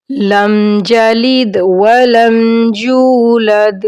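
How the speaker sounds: slow, drawn-out Arabic recitation. Shown as a demonstration. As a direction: recite each word slowly and clearly. Teacher recites prayer slowly.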